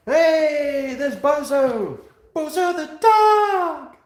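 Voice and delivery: high, loud delighted call, slowing down; thrilled dog greeting; excited calling out. Real, not acted. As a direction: greet the dog with a big happy shout.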